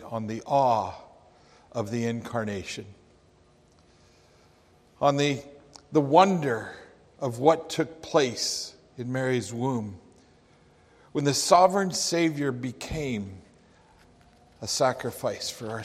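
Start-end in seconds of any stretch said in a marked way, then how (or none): none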